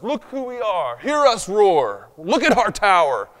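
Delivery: loud, slow, puffed-up mock boast; boastful builders. Preacher mocks the proud tower builders, loud slow boast.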